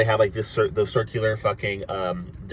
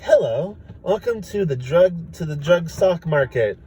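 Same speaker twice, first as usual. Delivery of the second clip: high, slow, sweet teller greeting; friendly alien teller; 8 semitones higher, slower than usual. Retold or imitated. Alien teller lady welcoming customers, sweet and high. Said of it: "the person who's all like".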